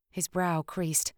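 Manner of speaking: usual speaking voice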